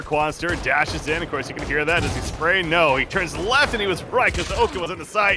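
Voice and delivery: excited play-by-play. Real, not acted. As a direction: build the hype with every kill. Live round play-by-play.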